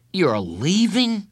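put-on high, drawn-out boy's disbelief; shocked disbelief. A narrator's reading. Boy learns his counselor is going, shocked. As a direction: stretch it out high, stunned.

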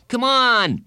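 high, loud goading call; urging. A narrator's reading. Bradley to friend who won't follow, urging. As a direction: push him to come along. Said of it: "Bradley urged".

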